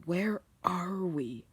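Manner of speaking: slow, lightly voiced brooding character; Shadow. A narrator's reading. Shadow wakes in a cave, asking slowly. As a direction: drag the question out in a slightly put-on voice. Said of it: "oh wait, that's Shadow".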